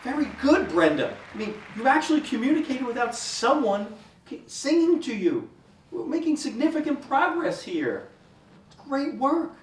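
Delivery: high, loud, delighted encouragement; effusive praise. Acted. Therapist praising Brenda's progress, high and enthusiastic. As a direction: gush over a patient's small success.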